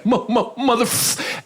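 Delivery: high, slow stammered curse; mock stutter. Husband recognising the comedian, mock stutter. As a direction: stall on M, then cut the curse short. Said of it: "the stutter kept getting worse and worse".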